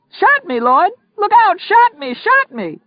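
frantic, very high-pitched screams; screaming. Acted. Wounded robber to his partner, screaming. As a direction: scream in panic to your partner. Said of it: "Polk screamed".

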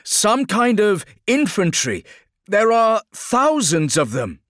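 high, loud alarmed report; urgent shout, drawn out at the end; shouting over battle. Acted. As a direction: call out the threat over gunfire. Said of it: "shouted Helicus against the crack and boom of battle".

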